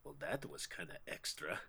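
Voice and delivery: very low, hushed deadpan; soft in-character understatement; understated aside. Acted. Player's in-character reaction to the death.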